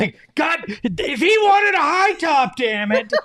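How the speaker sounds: laughing mock anger, high. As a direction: imitate her rant while laughing. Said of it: "I got a little angry at you there".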